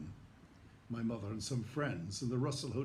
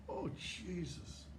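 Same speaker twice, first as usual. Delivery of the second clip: dread; 7 semitones higher, 6 dB quieter, much slower than usual. Retold or imitated. Retold dread.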